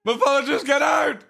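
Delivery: high, loud pretend scream; mock screaming fan. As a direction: yell him out of the room like a fan. Fan screaming bit once more.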